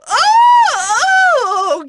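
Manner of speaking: high startled yelp of fright; startled shout. Acted. Teacher recoiling from a thing, yelps in fright. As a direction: jump back with a sharp cry.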